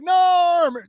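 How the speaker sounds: high, loud bar crowd calling out Norm; regulars' high cheer for a familiar face; Cheers bar greeting. Retold or imitated. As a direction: shout the name like the whole bar greets him. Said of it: "it reminded me of Cheers and Norm".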